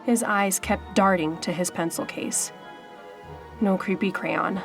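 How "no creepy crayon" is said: plain reading voice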